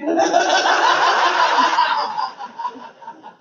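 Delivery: breathy giggle. Real, not acted. Laughing at own joke.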